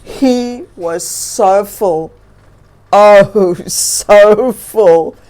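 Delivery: a stuffed belly laugh; full bear. Acted.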